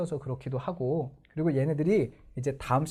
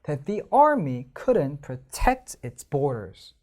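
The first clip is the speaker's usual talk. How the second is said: very slow, army clearly stressed; stressed reading; much slower than usual. Shown as a demonstration. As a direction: read it slowly, lightly marking stresses. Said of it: "읽어볼게요".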